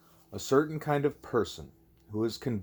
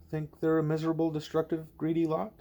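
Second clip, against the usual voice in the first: higher, disbelieving question; incredulous echo; 6 semitones higher than usual. Acted. Julie questions Ishmael's claim, higher and incredulous. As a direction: repeat the words as if you can't believe them.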